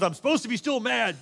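high, loud mock child tantrum; hammed-up boyish anger; stubbornly mad little boy. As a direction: imitate a kid insisting on his grudge. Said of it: "what he was even mad about".

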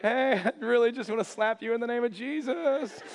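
high, sugary mock-friendly threat; hammed-up pleasant pastor voice; fake niceness. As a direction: threaten them through a forced smile. Pastor's fake-nice front while fuming.

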